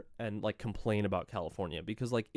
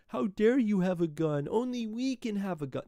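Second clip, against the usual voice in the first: higher mocking whiny voice; whiny mock Republicans; 9 semitones higher than usual. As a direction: mimic them like crybabies. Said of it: "they're crying".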